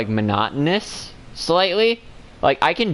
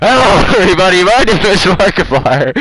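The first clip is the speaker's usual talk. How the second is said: fast, blaring intro shouted into the mic; loud mock Markiplier; 5 semitones higher, 9 dB louder than usual. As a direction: blast it loud and quick. Loud second take of intro.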